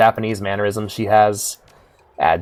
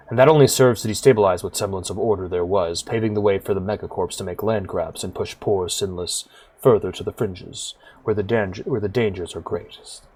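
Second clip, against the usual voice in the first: low-key, softer storytelling voice; subdued and grave, sinking quieter at phrase ends; weary big man, sombre; 3 semitones lower, 6 dB quieter than usual. Acted. Cluve confides his fears for the city to Whisper, sombre.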